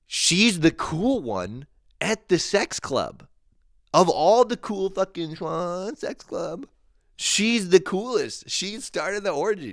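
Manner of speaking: high, loud, slow gushing over her; gushing quote. Retold or imitated. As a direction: rave about her in a high, thrilled voice. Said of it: "But I was like".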